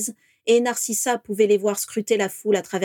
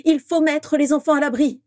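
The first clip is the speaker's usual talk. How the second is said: higher, louder urgent breathless plea; out of breath; 7 semitones higher, 5 dB louder than usual. Acted. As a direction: urge it while catching her breath. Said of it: "dit-elle, le souffle court".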